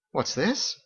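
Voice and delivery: high, bright-toned Irish-style hostess; Margot's lilting voice. A narrator's reading. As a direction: play the chatty innkeeper woman, high.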